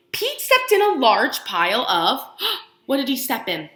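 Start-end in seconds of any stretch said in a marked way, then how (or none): none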